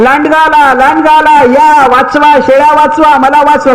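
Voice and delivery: put-on frantic boy yelling to save his goats; desperate shouting. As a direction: scream for help as the wolf really comes. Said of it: "हा ओरडून हैराण".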